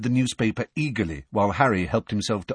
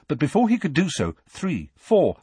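ordinary delivery, nothing marked

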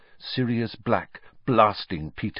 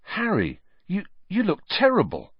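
worried friend; 13 semitones higher than usual. A narrator's reading. Ron greets exhausted Harry.

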